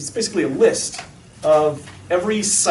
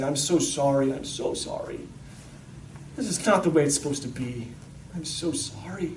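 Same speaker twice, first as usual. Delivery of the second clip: very soft, lower, sorrowful apology; tearful compassion, acted; 3 semitones lower, 8 dB quieter than usual. Jesus holding an exposed kid, softly sorrowful. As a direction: console gently, almost under the breath. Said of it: "Jesus would be crying".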